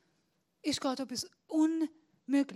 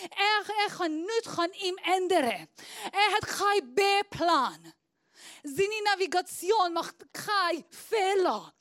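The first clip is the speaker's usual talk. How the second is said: fiery preaching; 5 semitones higher than usual. Acted. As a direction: proclaim it to the whole church. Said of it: "Ich tue viel schreien". (leidenschaftlich gepredigt)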